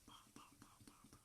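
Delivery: very faint spoken knocks; whispery knock-knock sound effect; imitated knocking. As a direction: tap out soft knocks with the voice.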